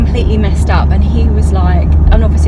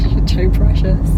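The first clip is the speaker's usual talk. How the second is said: ordinary delivery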